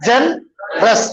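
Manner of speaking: Generous broken slowly as "जन … रस"; split into syllables. Shown as a demonstration. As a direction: stretch जन, pause, then रस. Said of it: "हम जन पे इतना बोल रहे हैं". (जनरस को धीरे, "जन … रस" तोड़कर)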